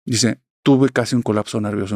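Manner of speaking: usual speaking voice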